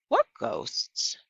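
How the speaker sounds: high, breathy startled question; startled child. A narrator's reading. Opal asks Gloria about the ghosts, high and startled. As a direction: gasp out the question in surprise.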